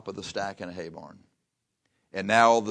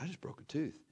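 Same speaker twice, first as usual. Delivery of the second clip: mock whisper; 6 semitones higher, 12 dB quieter than usual. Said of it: "when you whisper to your wife".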